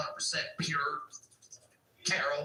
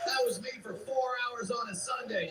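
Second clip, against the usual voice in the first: ordinary talk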